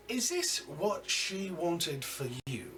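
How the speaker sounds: cold boss interrogating a kneeling man; menacing crime boss. Acted. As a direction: lean in and threaten him slowly.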